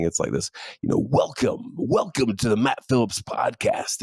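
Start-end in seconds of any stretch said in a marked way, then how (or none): 1.1-4.0 slow, put-on podcast intro voice; mock broadcast voice, big and drawn out; deep announcer voice. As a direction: imitate an overdone TV announcer welcome. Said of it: "this, like, really deep voice".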